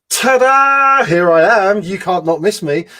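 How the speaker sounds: high, loud ta-da show-off voice; showy child. Retold or imitated. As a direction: play the attention-grabbing kid. Masking child showing off, quoted.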